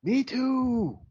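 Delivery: high, drawn-out exclamation; excited agreement. Real, not acted. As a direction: jump in with heartfelt fellow feeling. Excitedly shares the same zipper complaint.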